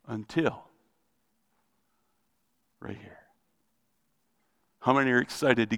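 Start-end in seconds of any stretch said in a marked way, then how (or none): 0.1-3.2 dropped to a near whisper; hushed. Acted. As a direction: lean in and hush it. Dramatic hushed reveal.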